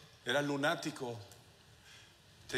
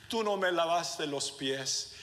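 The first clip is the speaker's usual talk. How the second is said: normal talking voice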